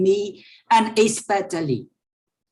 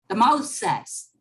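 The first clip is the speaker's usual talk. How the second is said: usual speaking voice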